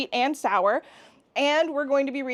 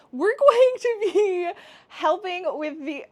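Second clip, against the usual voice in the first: words broken up by real laughter, pitch jumping higher; laughing through words; 7 semitones higher than usual. Real, not acted. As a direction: crack up mid-sentence. Loses track and cracks up.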